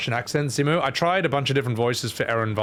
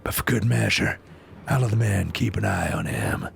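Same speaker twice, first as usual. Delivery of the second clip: measured, lower character voice; restrained in-character reading; chosen character voice; 3 semitones lower than usual. Acted. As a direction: play it composed, sinking at sentence ends. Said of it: "I reckon the first one".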